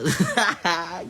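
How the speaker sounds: high, loud laugh that takes over; real laugh at her own tale; burst of laughter. Real, not acted. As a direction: crack up out loud.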